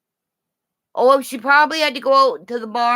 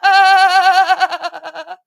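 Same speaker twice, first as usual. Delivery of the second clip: high bleating laugh; bleating cackle; goat-like laugh; 8 semitones higher, 6 dB louder than usual. Real, not acted. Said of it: "Do I sound like a goat?".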